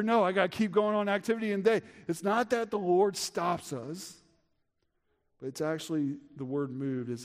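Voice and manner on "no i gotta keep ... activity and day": hurried excuse. Retold or imitated. Voicing a hurried believer's excuse.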